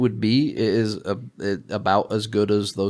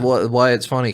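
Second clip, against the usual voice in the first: usual speaking voice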